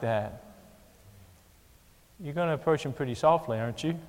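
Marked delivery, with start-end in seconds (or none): none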